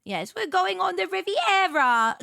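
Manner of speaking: hammed-up English holiday voice; terrible English accent. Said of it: "My terrible English accent".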